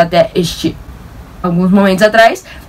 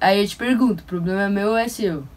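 much softer, confiding tone; quiet aside; 10 dB quieter than usual. Acted. Telling viewers why she skipped the medals, much softer. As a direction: drop your voice to a near hush. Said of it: "deliberately dropped voice aside".

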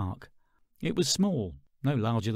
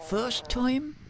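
put-on much higher, amused old lady; high-voiced knowing stallholder; chicken lady; 11 semitones higher than usual. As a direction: tease the newcomer gently.

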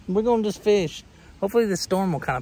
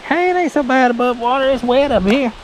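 high, cheery little fish voice; voicing the bluegill; 8 semitones higher, 6 dB louder than usual. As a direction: chirp it like a cheeky bluegill. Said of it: "He's like".

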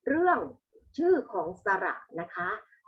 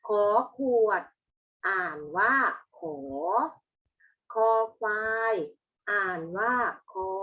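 classroom recitation; 4 dB louder than usual. Shown as a demonstration. Teacher leads pupils in reading the consonants, classroom recitation. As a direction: recite each letter name louder, then its sound.

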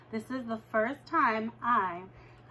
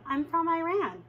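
high, bright, eager to please; extra cheerful; 7 semitones higher, 3 dB louder than usual. Retold or imitated. New girl telling a classmate her home, extra cheerful. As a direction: sell it cheerfully so you don't scare them. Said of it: "being extra cheerful and not scary".

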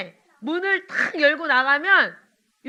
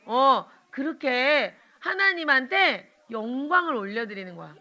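ordinary talk, nothing marked

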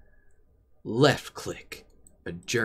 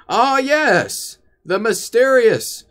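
mock awe; 9 semitones higher, 5 dB louder, slower than usual.

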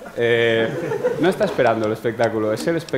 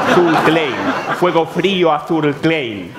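slow mock slogan; 4 semitones higher, 4 dB louder than usual. Slow mock-solemn product slogan.

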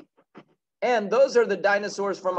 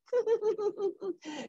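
a quick high giggle at her own joke. Real, not acted. Teacher giggling after voicing the boy to her class. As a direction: laugh lightly at the silly bit.

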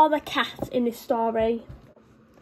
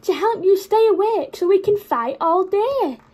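dog's reply; 6 semitones higher, 4 dB louder than usual. Acted. Dog answers.

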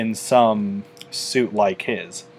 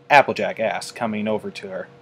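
ordinary delivery, nothing marked

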